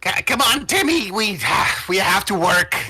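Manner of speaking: high, loud nagging; exasperated gnome. Acted. Gnome calls back to Timmy to get working, exasperated. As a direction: urge a slacking partner on, loudly. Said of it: "clearly very annoyed".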